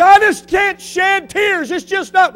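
high, slow, loud complaint; mock whiny congregant. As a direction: mimic the excuse, hammed-up.

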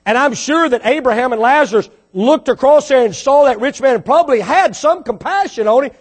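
normal talking voice

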